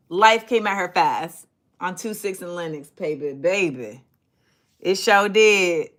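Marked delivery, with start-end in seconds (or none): none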